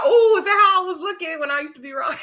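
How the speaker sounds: high-pitched comic horror at oneself; mock dismay. Vlogger seeing own old rocking habit in someone else, comically appalled. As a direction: cry it out as an appalled realization.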